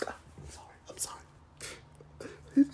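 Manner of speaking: whispered, slow, fake tearful apology; choked whisper. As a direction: whisper it like you're holding back tears. Fake crying, apologising to listeners.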